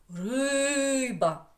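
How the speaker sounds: teacher's demo: РИИИ-ба; stress on first syllable. Shown as a demonstration. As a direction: stretch the "и" of "Риба", short "ба". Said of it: "Наголошений, звучить голосом сильно".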